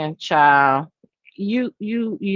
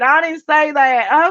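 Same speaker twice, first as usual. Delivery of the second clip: mock denial, hitting "I didn't say that" hard and drawn out; slow, insistent "I didn't say that"; emphatic denial; 6 semitones higher, 3 dB louder, slower than usual. Mimicking the panelist's emphatic denial. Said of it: "Okay, emphatically".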